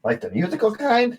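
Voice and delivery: very high curious gnome; gnome barkeep. Acted. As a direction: perk up at the question. Gnome asks.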